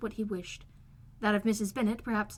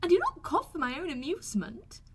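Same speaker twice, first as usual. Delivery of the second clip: put-on a touch of fretful girl, higher; fretful Kitty; 7 semitones higher than usual. A narrator's reading. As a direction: whine it back, lightly. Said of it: "said Kitty fretfully".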